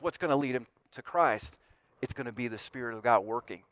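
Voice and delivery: normal talking voice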